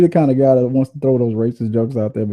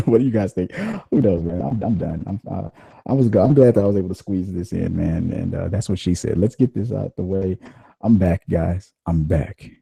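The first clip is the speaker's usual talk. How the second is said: normal talking voice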